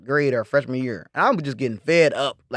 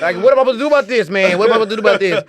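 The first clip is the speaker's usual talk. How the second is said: panicked quote; 6 semitones higher, 6 dB louder, faster than usual. Retold or imitated. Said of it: "I'm like".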